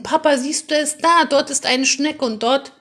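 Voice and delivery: put-on a boy eagerly showing his father shapes in the clouds; excited calling. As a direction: call to dad, thrilled. Child excitedly calls to father.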